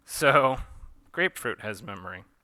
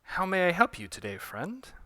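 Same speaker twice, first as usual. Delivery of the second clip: higher, louder sweet shopkeeper; eager shopkeeper; 8 semitones higher, 4 dB louder than usual. Acted. Shopkeeper welcoming a customer, sugary.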